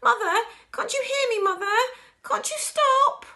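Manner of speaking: very high, loud desperate plea; wailing. A narrator's reading. Daughter begging her shrinking mother to stop, wailing. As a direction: wail pleadingly, drawing out the last words. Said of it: "wailed Missus Bucket".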